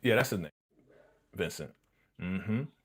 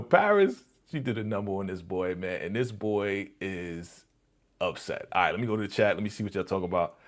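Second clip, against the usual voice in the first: ordinary talk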